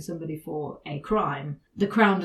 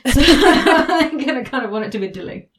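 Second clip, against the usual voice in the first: loud, higher, breaking into laughter; laughing; 6 semitones higher, 11 dB louder than usual. Real, not acted. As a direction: talk through a real giggle. Cracks up over rude-sounding word.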